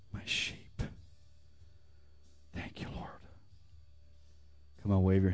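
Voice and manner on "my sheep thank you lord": soft whisper. Acted. Preacher whispering thanks to the Lord. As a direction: breathe it out.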